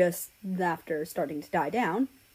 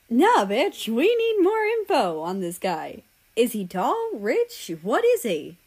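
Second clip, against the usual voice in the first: excited demand; 4 semitones higher, 5 dB louder than usual. Acted. Friend demands gossip excitedly.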